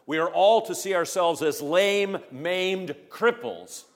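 loud, high preaching cry; rising to a ringing climax; preaching at full force. Acted.

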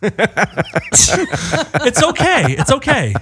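high, very slow mock comfort; mock soothing. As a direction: soothe her like a scared child.

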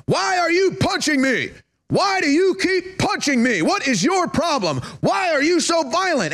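high-pitched wail of fake victimhood; mock indignant victim. Acts attacker crying victim.

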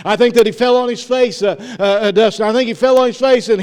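very loud, high preaching; full-force preaching. Real, not acted. Preacher picturing Thomas falling down, loud fervent preaching.

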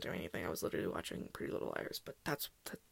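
whispered confession. Real, not acted. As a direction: say it under your breath. Confessing aside.

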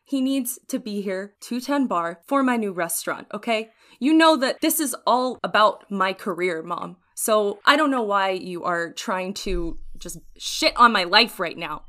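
rising rant, loud at the end; angry outburst. Acted. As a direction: tell off your mother, building to a yell. Player character yelling at mother.

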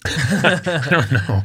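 slow words through a laugh. Real, not acted. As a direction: laugh it out slowly. Laughing reply to friend.

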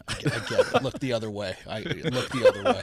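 can't get the coach's line out without laughing; laughing through a quote. Real, not acted. Pitcher voicing the coach about looking away, laughing loudly.

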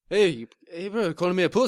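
put-on botched South African accent sliding into New Zealand; mistaken New Zealand accent. Co-host retrying the offended act, accent landing as New Zealand. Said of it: "What are you New Zealand for?".

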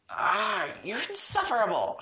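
high, loud frustrated cry; exasperated exclamation. Acted. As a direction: throw it out in exasperation. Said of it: "she exclaimed".

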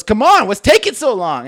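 acted-out exasperated demand; mock impatient yell. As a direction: imitate an impatient customer hurrying the clerk. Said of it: "this guy is just screaming at him".

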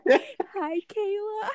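imitating a tearful, cracking greeting; high, mock-choked voice through amusement; Sergio. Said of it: "his voice was, like, just so cra-, it was cracking".

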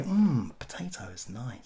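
hushed, savouring whisper. Pretend. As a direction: breathe it out like a secret.

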